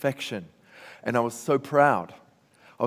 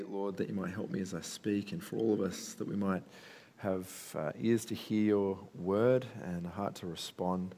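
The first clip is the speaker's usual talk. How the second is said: soft, reverent prayer voice; quiet prayer; 4 semitones lower, 9 dB quieter than usual. Acted. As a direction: pray it gently and softly. Opening prayer before sermon.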